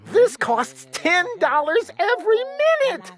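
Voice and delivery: high, urgent protest; alarmed cry. A narrator's reading. Sister protests the glunk's expensive call. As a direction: plead in alarm. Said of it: "my sister yelled".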